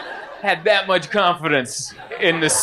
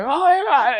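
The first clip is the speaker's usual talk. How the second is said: mock moved gasp; 12 semitones higher than usual. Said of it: "and I'm like".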